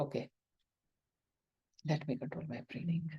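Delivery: soft whisper, self-calming; whispered to oneself. Shown as a demonstration. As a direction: whisper it as a private reminder. Teacher models calming herself.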